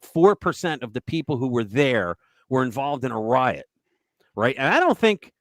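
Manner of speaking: ordinary talk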